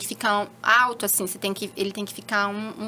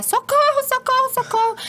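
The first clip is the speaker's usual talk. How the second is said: high, loud imitated scream for help; mother with the baby on her lap, screaming for help in alarm; panicked cry for help; 14 semitones higher, 3 dB louder than usual. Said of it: "minha mãe começa a gritar".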